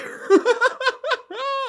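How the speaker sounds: shrieking giggle; high squealing laugh. Real, not acted. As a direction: cackle up high as you cut to the break. Laughs going to break.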